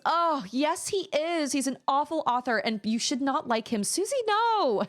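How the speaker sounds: high mocking imitation of a know-it-all client; snooty client. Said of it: "And he goes".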